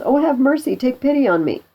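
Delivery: high-pitched plea for pity; acted begging. French prisoner begs Pistol for mercy, high and pleading. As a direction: beg your captor to spare you.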